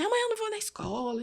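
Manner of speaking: put-on quick, high-pitched childish announcement; imitated child. Boy telling his carer he's skipping school, childish voice.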